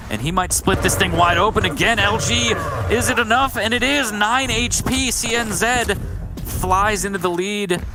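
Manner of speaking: high, building caster call; excited play-by-play. Real, not acted. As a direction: ride the climax of the fight. Caster calls the lead change.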